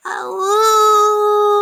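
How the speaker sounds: high, loud howling cry; wolfish howl. Acted. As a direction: howl like a wolf cub. Children howling in story.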